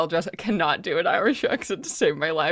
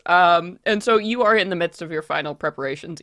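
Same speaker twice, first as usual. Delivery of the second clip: ordinary talk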